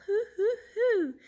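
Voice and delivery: high, loud hoots; owl hooting. A narrator's reading. Reader imitates an owl for children, high hooting. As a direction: hoot like an owl. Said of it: "He can sound like an owl".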